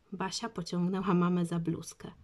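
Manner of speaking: plain reading voice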